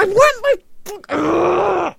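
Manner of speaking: high, loud demand breaking into a growled ugh; frustrated scream. Acted. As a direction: scream it until words give out. Screamed demand for missing product.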